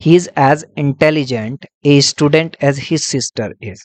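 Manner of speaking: each word spaced out slowly for notes; measured dictation pace. Shown as a demonstration. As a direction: read it out slowly so students can copy it down. Teacher dictating an example sentence.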